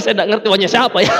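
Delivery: normal talking voice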